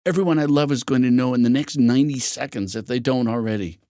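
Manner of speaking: plain reading voice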